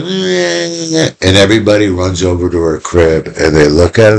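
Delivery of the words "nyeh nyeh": high thin mock baby whimper; whimpering nyeh, nyeh like a baby; baby cry imitation. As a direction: imitate a baby girl crying softly. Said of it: "all of a sudden she goes".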